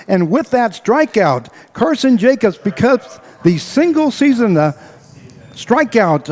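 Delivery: thrilled announcer, pitch jumping high; excited record call. Real, not acted. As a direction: shout the strikeout record home to the crowd. Record-breaking strikeout called live.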